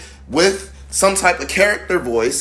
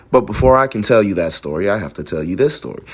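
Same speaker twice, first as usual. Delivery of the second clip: brisk, low-pitched matter-of-fact read; informational reading; 5 semitones lower, faster than usual. Shown as a demonstration. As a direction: read it straight through as plain information. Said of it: "If this sentence was information, it would be".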